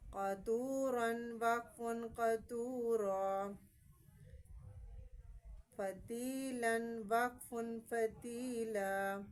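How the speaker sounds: slow with tanween, then the stop; drill reading. Shown as a demonstration. Teacher demonstrating the stop for pupils, slowly. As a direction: stretch تارکن, then clip it to تارک. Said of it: "یاد رکھنی ہے".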